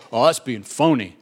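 low, dismissive critic; mock disapproval. Preacher mimicking critics of adaptable missionaries, mock disapproving. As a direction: grumble it like a disapproving onlooker.

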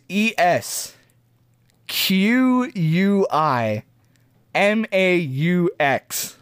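letters spelled out slowly; slow spelling. Shown as a demonstration. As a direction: draw out each letter one by one.

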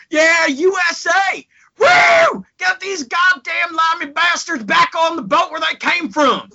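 hammed-up Texan crowd holler, high and loud; horrendous Texas accent. Texas crowd jeering British wrestlers, shouted Texas accent. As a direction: whoop and yell like a rowdy Texan fan. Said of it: "my horrendous Texas accent".

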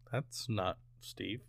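very soft, low and slow; hushed disbelief. Retold or imitated. Viewer reacting to the wrong voice on screen, hushed disbelief. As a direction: drop it right down, drawn out slowly.

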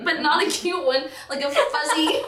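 laughing through the words; giggling as the picture lands. Real, not acted. Recalling her frizzed-up hair, laughing through it.

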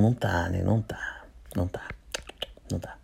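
fading whisper of repeats. Real, not acted. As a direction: whisper it away to nothing. Taunting poor viewers, fading repeats.

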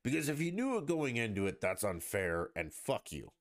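normal talking voice

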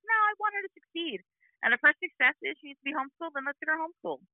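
high-pitched retold objection; emphatic insistence. Mom answering the therapist about homeschool, high insistence.

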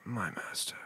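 low whispered servant voice; Vader reply. Pretend. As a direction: answer like a loyal Sith servant.

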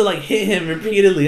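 ordinary talk, nothing marked

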